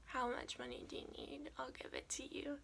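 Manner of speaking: very soft, gentle offer; gracious dad. Retold or imitated. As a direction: imitate a dad quietly offering help. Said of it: "my dad graciously says".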